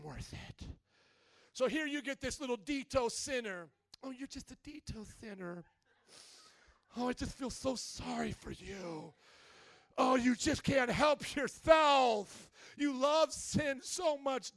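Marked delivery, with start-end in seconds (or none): 4.0-9.1 soft, put-on sympathy; hushed, sarcastic commiseration; mock pity. Mocking those who coddle sinners. 10.0-14.5 loud, slow, hammed-up sympathy; exaggerated, drawn-out commiseration; mock pity. Mocking those who coddle sinners.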